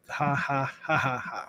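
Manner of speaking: low, soft spoken ha-ha-ha; deadpan read-out laugh. Shown as a demonstration. Reading a chat message's written laugh aloud, deadpan. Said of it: "I read it like that".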